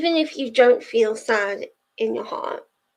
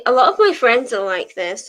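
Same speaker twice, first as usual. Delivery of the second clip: usual speaking voice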